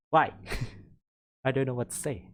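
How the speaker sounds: usual speaking voice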